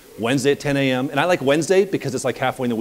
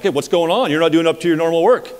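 louder, higher challenge from a boss; brisk acted reprimand, voice raised; mock confrontation; 5 semitones higher, 5 dB louder than usual. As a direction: imitate a boss calling someone out. Boss confronting an underperforming worker.

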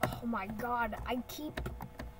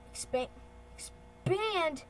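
drawn out, higher, swooping down; playful command; 4 semitones higher, slower than usual. Pretend. Talking to the computer while resizing, playful command. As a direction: stretch the word out playfully.

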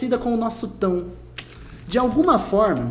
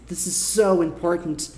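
"so" drawn out slowly, carrying the emphasis; stress on SO; slower than usual. Shown as a demonstration. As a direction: lean long on "so" in "This is so important". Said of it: "mais quer enfatizar isso aqui".

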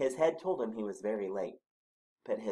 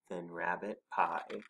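ordinary delivery, nothing marked

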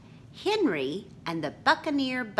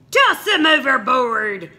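loud, high yelled pirate order; pirate captain yelling to his crew; 7 semitones higher, 11 dB louder than usual. Acted. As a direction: yell it to the crew. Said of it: "he yelled".

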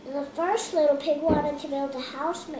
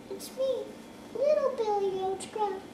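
high little goat voice; 6 semitones higher than usual. Acted. Little goat answers troll.

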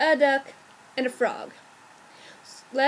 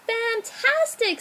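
high, slow exclamation of joy; storybook mom thrilled; delighted mama dog; 8 semitones higher, slower than usual. A narrator's reading. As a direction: stretch it out, pitched high. Mother delighted at the cat pulled out.

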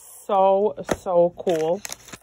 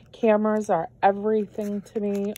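ordinary talk, nothing marked

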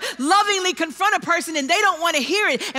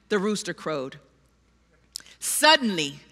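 normal talking voice

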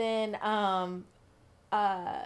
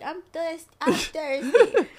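put-on whiny kid; 7 semitones higher, 7 dB louder, much slower than usual. Imitating whiny son Eli.

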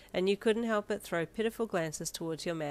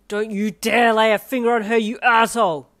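loud, raised, protective fury; furious shout; 5 semitones higher, 16 dB louder than usual. A narrator's reading. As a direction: shout a threat to defend someone. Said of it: "Darby shouted at the male".

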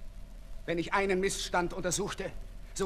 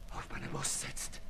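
fearful dread; 4 semitones lower than usual. Acted. Marat fears his murder.

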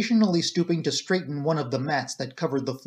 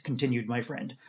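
ordinary delivery

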